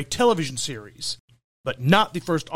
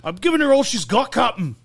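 put-on Scottish accent; 6 semitones higher, 4 dB louder than usual. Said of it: "in a very heavy Scottish accent".

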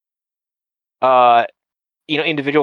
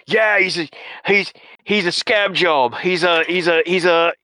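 higher-pitched mock cyberpunk street talker; cyberpunk slang; 4 semitones higher than usual. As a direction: stumble through made-up slang in a put-on voice. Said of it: "that cool cyberpunk slang".

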